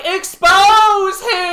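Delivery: put-on boy shrieking to unmask the spy; shout. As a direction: yell out the accusation.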